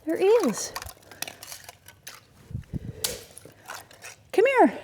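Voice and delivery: high sing-song voice to a pet animal; pet talk. Real, not acted. Farmer talking to her animal in high pet voice. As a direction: coo it high up like calling a pet.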